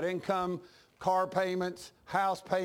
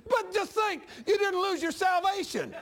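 real laughter, pitch up high; laughing; 9 semitones higher than usual. Real, not acted. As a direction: laugh through the mocking line. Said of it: "I started laughing".

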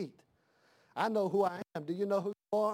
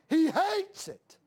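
preaching cry; 9 semitones higher, 4 dB louder, slower than usual. Real, not acted. Repeated for emphasis.

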